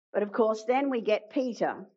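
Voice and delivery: ordinary talk, nothing marked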